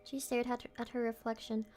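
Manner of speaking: story narration. Acted. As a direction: read the eerie text softly, drawing it out. Reads horror game text aloud.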